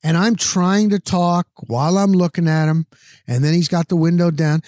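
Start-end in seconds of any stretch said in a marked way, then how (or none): none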